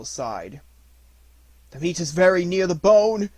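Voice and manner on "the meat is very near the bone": gasping. A narrator's reading. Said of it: "Gray Brother gasped".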